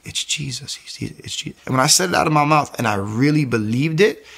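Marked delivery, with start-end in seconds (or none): 0.0-1.6 soft, halting, near-whispered awe; hushed realization. Retold or imitated. Retelling the revelation while reading Romans, hushed realization. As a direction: say it under your breath as it dawns on you.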